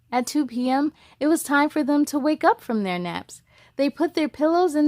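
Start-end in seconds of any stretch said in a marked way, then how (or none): none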